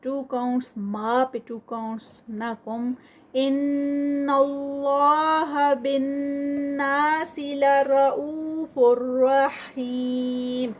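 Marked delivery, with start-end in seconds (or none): 3.3-10.7 very slow, melodic chant with held ghunna; Quranic recitation. Shown as a demonstration. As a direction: recite slowly, letting the nasal ring on ان. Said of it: "ان پہ غنہ ہے". (غنہ کے ساتھ بہت آہستہ تلاوت)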